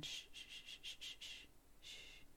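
long, very soft shh; shushing the boy to settle him. Acted. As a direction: hush him gently. Calming the boy down.

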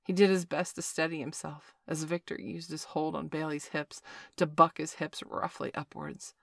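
plain reading voice

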